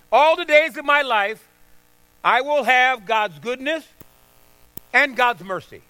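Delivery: loud, high, driving preacher's cry; pulpit-pounding sermon peak; full-force preaching. Acted. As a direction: preach it hard to the congregation.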